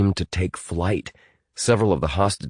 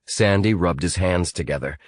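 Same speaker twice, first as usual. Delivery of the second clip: usual speaking voice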